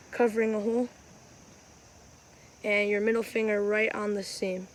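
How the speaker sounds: ordinary talk, nothing marked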